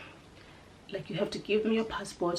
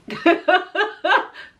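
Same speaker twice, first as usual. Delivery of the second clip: loud, higher-pitched burst of laughter; real laugh; 11 semitones higher, 10 dB louder than usual. Real, not acted. Laughing at her own fear of flying over water, loud laugh. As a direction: let a big relieved laugh out. Laughs after surviving the flight.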